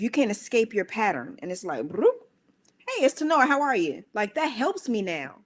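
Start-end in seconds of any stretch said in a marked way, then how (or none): 1.9-4.0 high, louder cheery hello; chirpy phone-call greeting, pitched up; bright phone greeting. Retold or imitated. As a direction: answer the phone all upbeat.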